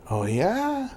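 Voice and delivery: high rising surprise; surprised. Retold or imitated. Chief hears where his former wrestler is sitting, surprised. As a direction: imitate a surprised questioning reply.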